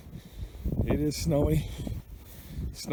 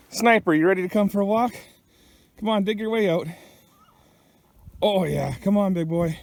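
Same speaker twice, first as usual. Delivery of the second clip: higher, upbeat call to the dog; 5 semitones higher than usual. Real, not acted. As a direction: coax the dog out cheerfully. Calling the dog to come.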